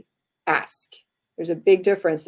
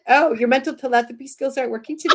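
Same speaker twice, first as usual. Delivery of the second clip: mock-sarcastic jab, a little higher and faster; 6 semitones higher, 6 dB louder, faster than usual. Wife mocking husband who can't read her mind, sarcastic. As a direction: needle him with fake surprise.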